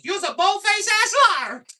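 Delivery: very high, loud, slow squawking yell; sassy lady yelling. Retold or imitated. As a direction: holler it like an outraged auntie in the crowd. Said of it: "she goes".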